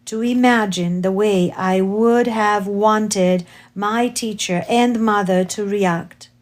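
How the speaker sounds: plain reading voice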